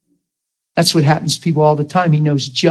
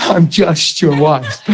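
slow, sighing mock of the wife; mock hurt wife; slower than usual. Preacher retelling his wife's words, a slow mock lament. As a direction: slowly echo her hurt words. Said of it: "She's like".

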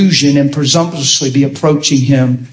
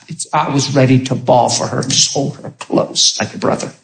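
ordinary talk

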